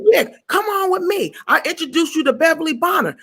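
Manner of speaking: high, lilting invitation; high-pitched welcoming man; high camp guy. Retold or imitated. Club guy voiced high.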